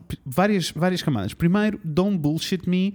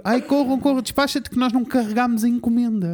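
hurried mover; 6 semitones higher than usual. Retold or imitated. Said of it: "um disse para o outro".

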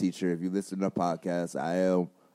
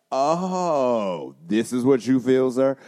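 a parent's raised, surprised exclamation, louder and higher; mock parent; 4 semitones higher, 7 dB louder, much slower than usual. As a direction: play a startled parent who finally gets it. Parent voiced hearing the podcast.

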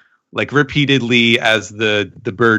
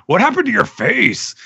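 mock surprised; 12 semitones higher than usual. Ninja teasing Denjiro, voiced.

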